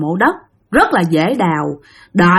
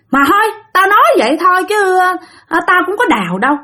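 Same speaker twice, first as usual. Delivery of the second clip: high-pitched, quick tipsy retraction; acted drunken protest, pitched up; drunk backing off; 9 semitones higher, faster than usual. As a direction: brush it off in a raised voice. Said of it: "ông Sáu Xị này mới chửi".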